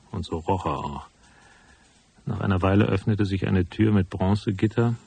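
loud, close narrator voice over the scene; story narration. A narrator's reading. Detective narrating his visit to the office, in a forward narrator read. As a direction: lay the story out like a hard-boiled detective.

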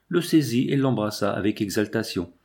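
usual speaking voice

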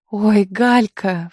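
slow, drawn-out exclamation of remembering; suddenly remembers news to share; sudden recollection. A narrator's reading. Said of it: "вспомнила она".

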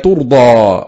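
ترضى said turda, with a long final aa; damma on ta. Shown as a demonstration. Teacher showing al-Kisa'i's reading, ترضى with damma on the ta. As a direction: open ترضى on tu and stretch its ending. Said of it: "بضم التاء".

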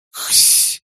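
high, loud, hissed syllables; creature attempting speech. A narrator's reading. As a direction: force a word out through hisses. Doll straining to say the word. (шипящая попытка заговорить)

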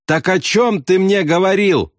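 high voice, innocently asking; oblivious questioner. A narrator's reading. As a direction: ask innocently in a high call. Navigator asks again over the noise.

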